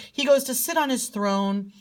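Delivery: ordinary talk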